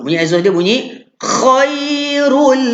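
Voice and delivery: khairul with a clear, non-nasal l at the end, said slowly; khairul demo. Shown as a demonstration. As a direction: model khairul, ending crisply on l. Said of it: "bunyi exhaust tak bunyi hidung". (sebut khairul dengan l yang jelas, tanpa bunyi hidung)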